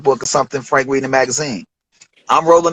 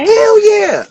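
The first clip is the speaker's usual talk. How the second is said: much higher, loud thrilled yes; eager yes; 4 dB louder than usual. Retold or imitated. Rapper accepting a video offer, thrilled. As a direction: jump at the offer. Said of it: "I'm like".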